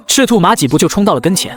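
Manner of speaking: usual speaking voice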